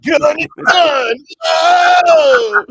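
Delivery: high, loud, slow catchphrase yell; shouted catchphrase. Real, not acted. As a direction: belt it out as a sign-off.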